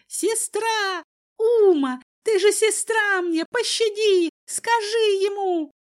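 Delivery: very high, loud wailing; screamed plea for mercy. A narrator's reading. Cousin begs his sister to save him, screaming. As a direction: cry out desperately, high and loud. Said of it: "кричал Киран".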